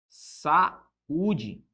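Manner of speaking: saúde split into sa-ú-de, the ú held alone; saúde pronounced in separate syllables; hiatus demo. Shown as a demonstration. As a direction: pull saúde apart at the ú. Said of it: "esse U fica sozinho".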